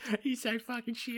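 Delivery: high, mocking catty voice; mocking demon gossip. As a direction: jeer like a spiteful gossip, pitched high.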